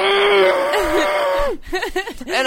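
high strangled laughing yelp; pained high yelp. Retold or imitated. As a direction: squeal like it really hurt. Dick caught in zipper.

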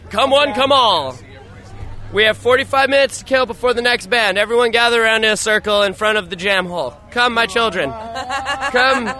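hammed-up call to the flock; mock preacher. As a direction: carnival barker gathering a crowd, hammed up. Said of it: "Audience participation".

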